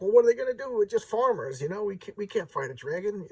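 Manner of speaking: a lightly voiced critter shrugging it off; squeaky possum voice. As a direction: pipe it up like a small talking possum. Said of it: "the possum's like".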